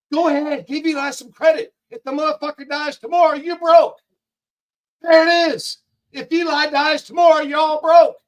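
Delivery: angry shout. Real, not acted. Streamer yelling at chat that they live off Eli. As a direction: hammer it home to the chat. Scolding chat about Eli's money.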